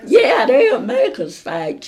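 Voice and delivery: slow, laughing and loud. Real, not acted. Laughing at fight memory.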